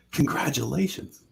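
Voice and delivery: high-pitched, impressed imitation; awed congratulations. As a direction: congratulate as if in awe, put on. Imitates star congratulating supposed champion.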